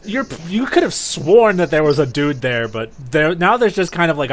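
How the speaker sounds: ordinary talk, nothing marked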